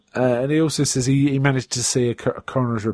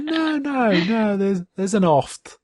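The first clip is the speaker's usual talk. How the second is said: high mock woman; 8 semitones higher, slower than usual. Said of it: "she said".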